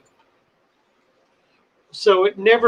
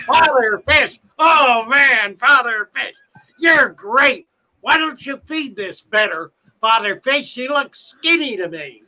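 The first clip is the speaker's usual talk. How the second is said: put-on excited kid; 6 semitones higher than usual. Teen wearing the boa, chattering at the owner, eager and high. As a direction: chatter eagerly with a snake round your neck. Teen with snake round neck.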